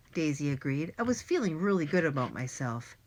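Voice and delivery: plain reading voice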